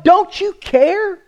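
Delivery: high and slow on Don't, stretched in protest; mock-hurt high protest; indignant question. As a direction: wail the reproach up high. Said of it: "read between the lines".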